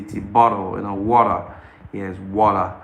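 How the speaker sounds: usual speaking voice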